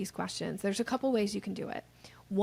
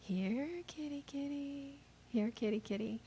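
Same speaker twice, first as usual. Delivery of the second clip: cat calling; 5 semitones higher, 7 dB quieter than usual. Shown as a demonstration. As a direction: coax a cat gently. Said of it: "how you call a cat".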